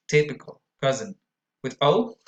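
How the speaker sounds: ordinary delivery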